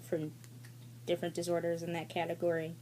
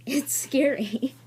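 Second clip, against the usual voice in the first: slow, louder, laughing through it; laughing repeat; 6 semitones higher, 7 dB louder, much slower than usual. Shown as a demonstration. Repeating her fear to viewers, slow laughing emphasis. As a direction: draw it out with a laugh.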